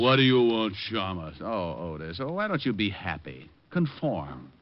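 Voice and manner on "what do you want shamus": mocking echo. Detective mocks the desk sergeant's greeting, teasing mimicry. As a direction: parrot the gruff greeting back, louder and mocking.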